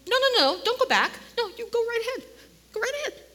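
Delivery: high, sing-song angel telling him to go on. Acted. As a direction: lift the voice high and lilting for the angel. Angel voiced in retold story.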